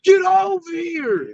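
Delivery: slow, drawn-out shouted battle cry; Scorpion's "Get over here". Retold or imitated. Quoting Mortal Kombat's Scorpion to the hosts, slow roaring shout. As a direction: yank them in with a slow roaring call. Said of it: "like Scorpion".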